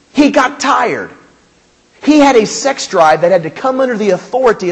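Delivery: fiery preaching. Real, not acted. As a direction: preach it hard, building. Preaching at full force.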